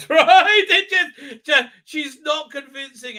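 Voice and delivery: real giggle of disbelief; laughing. Real, not acted. Laughing at the show.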